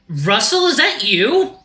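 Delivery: high, loud, surprised squeal of recognition; excitable Axel voice. Acted. Axel recognizes an old school friend, high excited voice. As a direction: pitch it way up, delighted.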